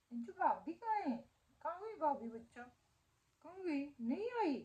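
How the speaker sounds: put-on high, sing-song baby talk to the dog; pet talk. Asking the dog where her sister-in-law went, high baby talk. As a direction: coo at the dog like to a small child. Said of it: "अगर भाभी वाला topic छेड़ दो ना".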